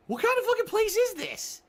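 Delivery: high, shocked outraged cry; incredulous outburst. Retold or imitated. Venting at the scornful nurse, high outraged cry. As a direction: blurt it out in disbelief. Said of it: "And I'm like".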